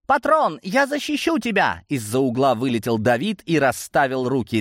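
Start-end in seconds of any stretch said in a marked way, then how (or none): 0.1-1.8 very high, heroic cry; eager little defender. A narrator's reading. David shields his patron from Gromova, heroic cry. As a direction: pipe up high, rushing to the rescue.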